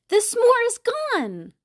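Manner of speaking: high, slow, amazed reveal; surprised reveal. A narrator's reading. Reading the discovery to children, high and slow with surprise. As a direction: let the children gasp at the empty spot.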